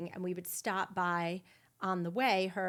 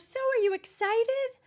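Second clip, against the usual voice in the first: much higher and louder, imitating a bubbly cousin; mock cheery cousin; 8 dB louder than usual. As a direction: mimic her sing-song excitement, high and bright. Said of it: "and she goes".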